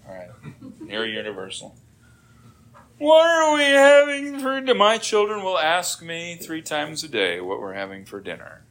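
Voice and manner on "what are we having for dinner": high, loud, whining mock-child voice; imitated child's whine. Child nagging a parent about dinner, mock whining. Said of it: "anybody ever whine to their mom and dad?".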